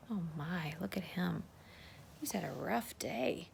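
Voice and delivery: soft sympathetic whisper; gentle whispered pity. Real, not acted. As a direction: hush it like he might wake. Sympathy for tired farmer.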